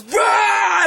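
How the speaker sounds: high "bah" blast noise; hammed-up Godzilla blast; imitated monster roar. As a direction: roar out atomic breath, high-pitched. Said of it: "and just goes".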